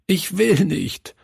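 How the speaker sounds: put-on high, pleading child's protest; a boy sobbing in protest. A narrator's reading. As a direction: beg not to go, close to tears. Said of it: "schluchzte er".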